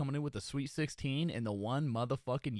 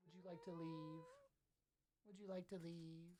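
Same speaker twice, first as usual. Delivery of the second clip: voicing the cat; 2 semitones higher, 22 dB quieter than usual. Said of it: "And just going".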